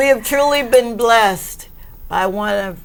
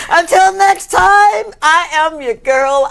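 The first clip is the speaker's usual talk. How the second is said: show sign-off; 8 semitones higher, 5 dB louder than usual. Acted. Host's sign-off.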